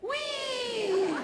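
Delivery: put-on very high, delighted child's whee; imitating a five-year-old's delighted squeal; gleeful whee. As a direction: squeal like a kid on a ride. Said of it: "this boy would go".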